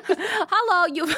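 high Midwestern hello through a laugh; Midwestern accent, giggling. Pretend. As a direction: try the Midwestern greeting while cracking up. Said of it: "in that accent".

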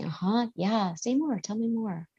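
soft, gently lifted prompts; soft murmurs of interest, imitating a present parent; encouraging listener. Shown as a demonstration. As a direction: quietly invite a child to keep talking. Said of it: "And you just say".

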